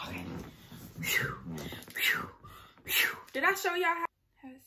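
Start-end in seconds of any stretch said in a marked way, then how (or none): 1.0-3.2 nervous exhales. Real, not acted. Calming nerves before the call.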